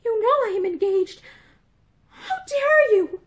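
high, affronted rebuke; acted scandalised outburst; indignant outrage. A narrator's reading. Engaged woman outraged by proposal.

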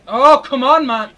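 loud, high outburst of frustration; exasperated shout. Real, not acted. Gamer losing his first game, exasperated shout. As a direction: protest loudly, getting mad. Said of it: "I'm actually getting mad".

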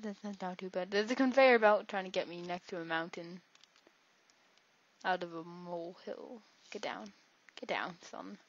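ordinary delivery

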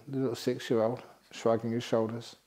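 ordinary talk

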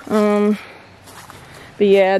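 ordinary talk

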